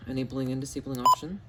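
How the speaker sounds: normal talking voice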